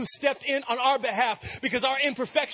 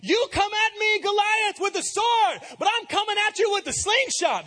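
much higher, loud hammed-up battle cry; mock heroic shout; 12 semitones higher, 6 dB louder than usual. Shown as a demonstration. Preacher mocking how David's line should have sounded, hammed-up and shouted. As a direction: overdo it like a cartoon hero. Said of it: "It should have sounded like".

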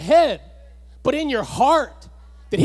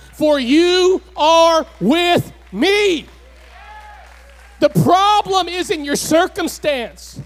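preaching at full force; 3 dB louder than usual. Acted. Preacher voicing God, then shouting.

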